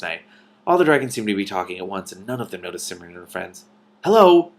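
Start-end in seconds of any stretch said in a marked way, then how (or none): 4.0-4.5 slow, loud call over a noisy crowd; shout. A narrator's reading. Princess hailing a crowd of dragons, loud slow shout. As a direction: call out to be heard over the chatter. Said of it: "Cimmerian shouted".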